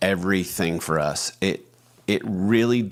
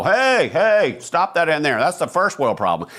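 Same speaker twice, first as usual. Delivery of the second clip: higher, louder, hammed-up scold; mock scolding; 8 semitones higher, 5 dB louder than usual. Husband teasingly scolding his frustrated wife, higher and louder. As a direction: wag a finger at her in a joking lecture. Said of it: "I'll go".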